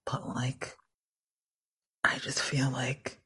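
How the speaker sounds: soft unvoiced whisper. Real, not acted. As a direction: breathe it like a secret. Aside dropped to whisper.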